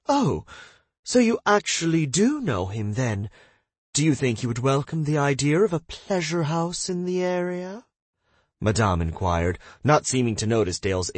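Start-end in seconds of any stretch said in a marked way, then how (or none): 0.1-7.8 eager lady voice. Acted. Madame eager question.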